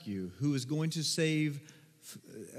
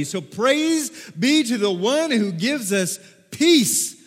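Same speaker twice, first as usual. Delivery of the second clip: slow, louder, high ringing acclaim; excited praise; 6 semitones higher, 8 dB louder, slower than usual. Acted. Crowd hailing the one who brings peace, slow and loud. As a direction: proclaim it like a cheering crowd.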